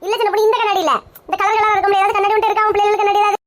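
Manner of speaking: ordinary talk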